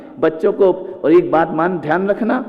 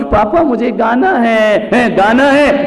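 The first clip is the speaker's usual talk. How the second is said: put-on child whining; 6 semitones higher, slower than usual.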